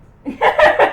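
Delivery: loud, high laugh; sudden real cackling laugh; burst of laughter. Real, not acted. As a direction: crack up loudly. Cracks up at fish joke.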